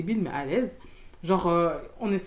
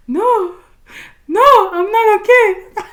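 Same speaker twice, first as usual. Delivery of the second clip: high, loud mock wail; drawn-out, tearful re-enacted outburst; acted crying; 11 semitones higher, 12 dB louder, much slower than usual. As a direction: wail it in hammed-up distress. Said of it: "je commence à pleurer".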